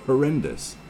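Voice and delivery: ordinary talk, nothing marked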